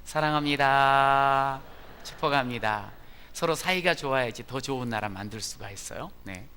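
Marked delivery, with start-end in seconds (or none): 0.0-2.9 slow, loud, drawn-out blessing to each other; loud greeting. Pretend. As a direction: call it out warmly to your neighbour. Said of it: "시작".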